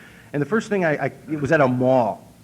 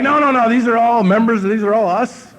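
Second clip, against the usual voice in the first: loud cheerful insistence; 9 semitones higher, 8 dB louder than usual. Retold or imitated. Man cheerfully insists loudly.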